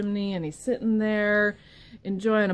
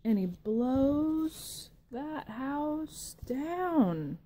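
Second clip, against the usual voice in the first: drawn out very slowly; slow dramatic blow; 6 semitones higher, much slower than usual. Acted. Storyteller drawing out the house falling, very slow. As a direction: stretch it out for the big moment. Climax of the blowing.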